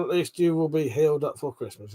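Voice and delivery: usual speaking voice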